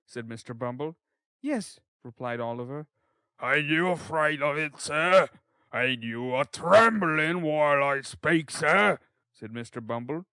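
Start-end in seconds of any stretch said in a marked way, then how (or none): 1.4-1.8 put-on high, short boyish reply; high-pitched child character; boy's voice. Defiant boy answers from inside. 3.4-9.0 loud, swelling pompous threat; booming official bullying through a door; intimidating beadle. Acted. Beadle tries to frighten the boy.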